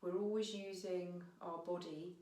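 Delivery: unhurried meditation guiding with long pauses; guided meditation. Real, not acted. Teacher guiding listeners to notice their breath, calm meditation voice. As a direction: ease them gently inwards, phrase by phrase, leaving space.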